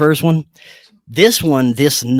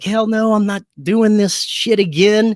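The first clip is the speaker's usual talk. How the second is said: retold panicked refusal, pitched up high; freaking out; 6 semitones higher than usual. Man refusing to start over from the beginning, freaking out. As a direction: replay your freak-out in a raised voice. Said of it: "I just started freaking out".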